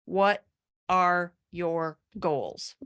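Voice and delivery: very slow, each word set apart. Retold or imitated. Coach quotes the four words that caused trouble, slowly and deliberately. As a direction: pause after "What", then spell out "are your goals".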